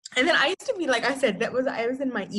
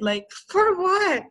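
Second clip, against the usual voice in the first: laughing; 9 semitones higher, slower than usual. Real, not acted. Laughing at own silly story.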